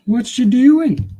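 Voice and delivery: high, slow puzzled voice for the pet; high slow mock bewilderment; voicing the snake. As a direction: voice the pet's confused thoughts, drawn out. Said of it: "You're like".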